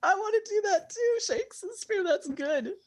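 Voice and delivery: high, giggling delivery; laughing through words. Real, not acted. Player laughing at the codpiece joke, giggling high. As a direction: crack up while talking.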